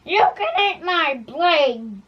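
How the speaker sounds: slow, loud battle cry; defiant shout. Acted. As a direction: hurl it back at the dragon. Knight defies the dragon.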